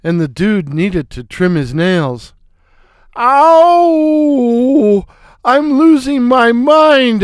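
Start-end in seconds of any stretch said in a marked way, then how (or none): 3.2-7.2 high, loud wail of pain; hammed-up cry of pain. Poked in both eyes, he wails high and loud. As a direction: yelp it out high and pained. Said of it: "I cried".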